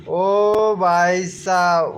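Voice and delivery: very slow, stretched-out "ओ भाई साहब" with a long "ओ"; drawn-out exclamation. Acted.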